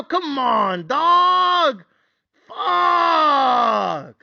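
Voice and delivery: high-pitched rant building to long howls; exasperated tirade. Acted. Bettor berating his team for the blown lead, furious exasperation. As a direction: climb from scolding into drawn-out wailing curses.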